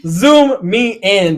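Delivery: slow and loud, zoom stretched; loud demand. Pretend. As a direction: call out slowly, stretching zoom.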